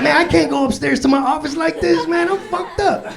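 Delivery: high-pitched stoned freak-out; mock panic. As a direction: play it hammed-up, helplessly too high to move. Stoned, stuck in lobby, panicking.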